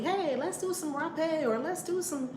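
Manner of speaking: lightly imitating someone flippant; high, breezy mock suggestion; mock willy-nilly. Host mimicking careless ayahuasca seekers, mock breezy voice. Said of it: "It's not just done willy-nilly".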